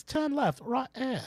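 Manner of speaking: put-on high Siri with an Australian accent. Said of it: "I made mine sound Australian".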